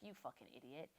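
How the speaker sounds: a soft, nasty mock of inner self-hate; harsh inner voice. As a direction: voice the cruel voice in your head under your breath. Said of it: "It's like".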